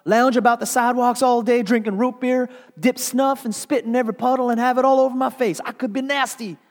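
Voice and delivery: high, loud, contemptuous listing; furious woman mocking the trash; scornful rant. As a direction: spit out the list in disgust. Continuing her furious rant.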